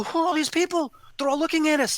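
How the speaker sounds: high-pitched retold amazement; astonished question. Speaker asks his spirit guide about the crowd, astonished. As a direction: ask in amazement, pitch jumping up.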